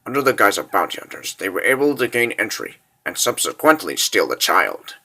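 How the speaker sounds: low, subdued military report; grave briefing. Acted. Officer briefing his lord on the kidnapping, grave and low. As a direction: report it softly and low, measured.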